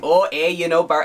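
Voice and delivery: loud, high, hammed-up Wisconsin accent; a failed stab at a Wisconsin accent; mock Wisconsin accent. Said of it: "I can't even do it".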